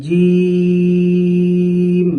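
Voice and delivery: teacher chanting জিম slowly; drawn-out letter demo. Shown as a demonstration. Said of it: "জিহ্বার মধ্যখান তার বরাবর উপরের তালুর সাথে লেগে".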